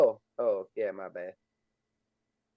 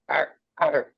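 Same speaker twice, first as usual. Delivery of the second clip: two short barked yaps in a human voice; dog bark; 3 semitones higher than usual. Acted. Game master voices the beast barking to alert another, lightly barked. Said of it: "And he barks".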